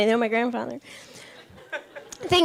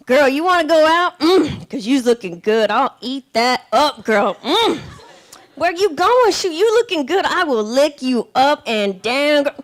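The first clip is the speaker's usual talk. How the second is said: louder, slow smooth come-on; 4 dB louder, slower than usual. Retold or imitated. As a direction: lay it on thick and slow. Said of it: "they'll be like".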